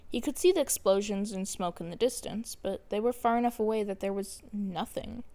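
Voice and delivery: plain reading voice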